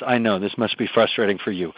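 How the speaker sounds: low, soft and calm; empathetic. Shown as a demonstration. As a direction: soothe the upset teen gently. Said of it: "with empathy".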